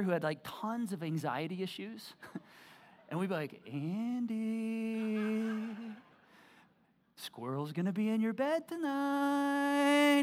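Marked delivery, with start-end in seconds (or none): 3.7-10.2 spooky tease. Retold or imitated. Teasing little brother.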